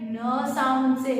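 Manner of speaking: usual speaking voice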